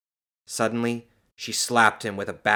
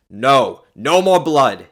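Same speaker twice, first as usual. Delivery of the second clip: loud, forceful refusal; defiant; 5 semitones higher, 13 dB louder, slower than usual. A narrator's reading. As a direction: put your foot down. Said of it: "he said defiantly".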